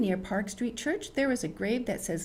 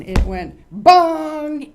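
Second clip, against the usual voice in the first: a huge slow bong; loud drawn-out bong; clock bong; 8 semitones higher, 15 dB louder, much slower than usual. Acted. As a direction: boom it out like a clock bell. Imitates clock striking.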